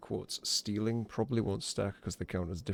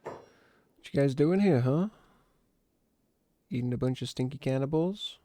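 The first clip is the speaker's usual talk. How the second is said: taunting voice; 5 semitones higher, 4 dB louder than usual. Acted. Taunting the wolves in game.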